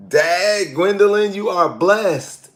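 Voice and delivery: high, loud exclaim, stretching blessed slowly; amazed. Real, not acted. Host marvelling at an older viewer's birth year, loud and high.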